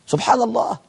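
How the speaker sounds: ordinary delivery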